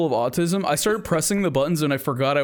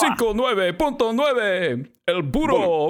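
slow, higher hyped radio call; Mexican radio announcer; 7 semitones higher, slower than usual. Acted. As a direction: announce it like a Spanish-language DJ. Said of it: "the Mexican radio guy".

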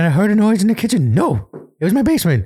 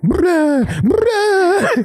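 mock dejected ghost; 10 semitones higher than usual. Ghost's disappointed reply.

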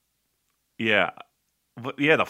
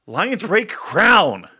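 mocking title; 6 semitones higher, 4 dB louder, much slower than usual. Real, not acted. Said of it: "What the fuck does that mean".

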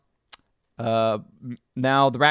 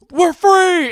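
mock cheer; 9 dB louder than usual. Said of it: "they're like".

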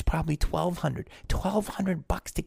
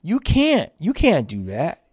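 loud, higher scolding "you can't"; discouraging family; 3 semitones higher, 6 dB louder, slower than usual. Retold or imitated. Family telling the young actor no, loud and higher. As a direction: shut the dream down hard. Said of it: "They, they learned that".

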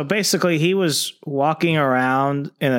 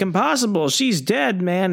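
dismissive henchman; 7 semitones higher than usual. Retold or imitated. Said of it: "And another guy's like".

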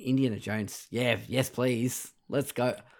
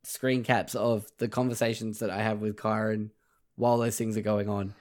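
ordinary talk

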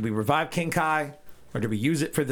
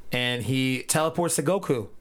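ordinary talk, nothing marked

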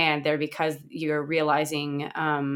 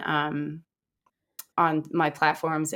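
ordinary delivery

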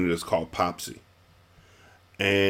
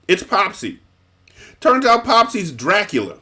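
high, louder twist reveal; spring the twist with glee; excited reveal; 11 semitones higher, 5 dB louder than usual. Real, not acted. Telling friend the twist ending, excited high reveal.

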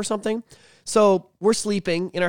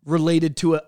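normal talking voice

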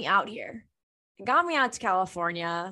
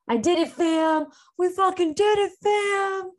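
higher and louder, triumphant squeal; excited; 11 semitones higher, 4 dB louder than usual. Real, not acted. Podcaster celebrating quitting her job to listeners, excited and much higher. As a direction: cheer it out like a victory.

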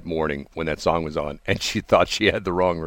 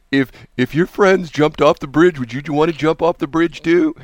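louder, lecturing parent; parent's old saying; 7 semitones higher, 5 dB louder than usual. Retold or imitated. A lightly voiced parent lecturing their kid, louder. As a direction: scold like a parent quoting the old line. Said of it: "what's the old thing".

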